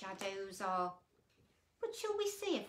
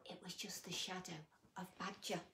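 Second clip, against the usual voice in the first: soft, quick unvoiced whisper; 3 semitones lower, 7 dB quieter, faster than usual. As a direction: let them in on the secret in a whisper. Whispered reveal to children.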